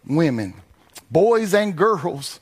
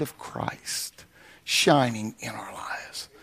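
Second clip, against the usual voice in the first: whisper; 6 semitones lower, 7 dB quieter, slower than usual. Real, not acted. Preacher drops to a hush.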